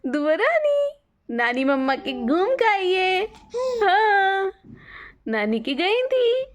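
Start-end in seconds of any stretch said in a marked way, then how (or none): 0.1-0.9 much higher sing-song call of her name. Real, not acted. Calling baby in baby talk. (बच्ची को ऊंची आवाज़ में पुकारना)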